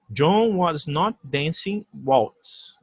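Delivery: very slow, deliberate English; slow model negative. Shown as a demonstration. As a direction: read the negative slowly. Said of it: "Só um detalhe aqui". (frase negativa lenta)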